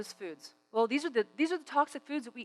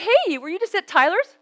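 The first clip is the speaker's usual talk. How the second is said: high, loud retort; calling out; 10 semitones higher, 8 dB louder than usual. Retold or imitated. Speaker questioning a man from next door's restaurant, loud and high. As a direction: call across the room to the heckler. Speaker calls back to heckler.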